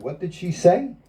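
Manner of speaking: lightly voiced old teacher, curious and pleased; amused guru asking. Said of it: "he said".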